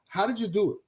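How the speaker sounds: normal talking voice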